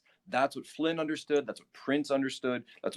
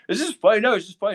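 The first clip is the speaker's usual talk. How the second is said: amused laugh; 9 semitones higher, 7 dB louder, much faster than usual. Real, not acted. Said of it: "I'm just laughing".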